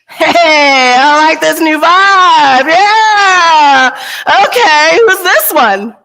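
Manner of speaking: high, loud, gushing cosmic voice, slow at first; delighted universe. Retold or imitated. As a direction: play the universe thrilled by a new vibe. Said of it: "the universe is like".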